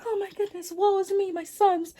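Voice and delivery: very high, hammed-up motherly woe; mock lament. Acted mother laments her sons.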